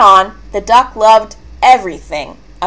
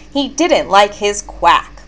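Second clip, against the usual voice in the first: usual speaking voice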